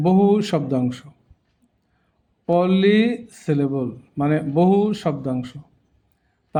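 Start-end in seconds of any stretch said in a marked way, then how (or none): 2.5-3.9 polysyllable slowly, in separated parts; broken into syllables. Shown as a demonstration. Teacher repeating the term, polysyllable slowly in pieces. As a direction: stretch the first syllables of polysyllable. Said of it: "ভেঙে ভেঙে দেখানো হয়েছে".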